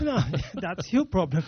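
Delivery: real laugh breaking into the words; laughing retort. Real, not acted. Questioner tossing the problem back to the speaker, laughing.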